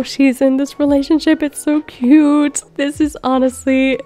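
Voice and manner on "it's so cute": normal talking voice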